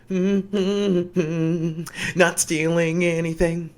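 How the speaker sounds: high, drawn-out singsong hum and words; mock-innocent thief humming to cover up; feigned innocence. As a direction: act suspiciously casual, stretching out each word. Shopkeeper feigning innocence while stealing.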